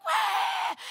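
high, loud throwing cry; mock battle cry of a crowd; imitated crowd yell.

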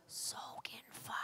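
barely audible, drawn-out whisper; God's whispered voice. Retold or imitated. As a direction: stretch it out in the softest hush. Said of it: "And he goes".

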